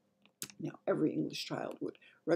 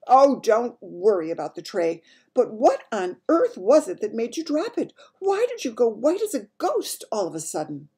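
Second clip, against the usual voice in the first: loud, raised royal insistence; exasperated Queen; 6 semitones higher, 9 dB louder than usual. Acted. Queen questioning maid about dropped tray, exasperated. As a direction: press hard for an answer.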